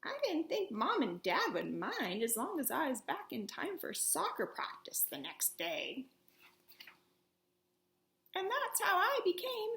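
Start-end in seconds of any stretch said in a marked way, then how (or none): none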